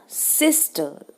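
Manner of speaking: Sister with weight on सिस, falling टर; first-syllable stress. Shown as a demonstration. Teacher recapping what comes first, front-stressed sister. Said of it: "तो stress first syllable पे आ गया".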